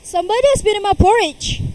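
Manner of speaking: loud, fast, high booming bear; great huge bear voice. A narrator's reading. Father bear growls to Goldilocks's intrusion, in a big booming voice. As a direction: boom it out like a huge papa bear. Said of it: "in his great huge voice".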